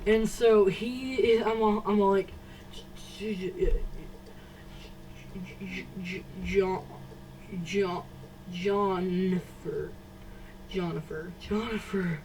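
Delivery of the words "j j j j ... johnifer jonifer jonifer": hushed, repeated stuck J sounds before the name; imitated stuttering. Trying to get the friend's attention in class, imitated stutter. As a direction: struggle through the first sound of John. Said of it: "I'm just stuttering".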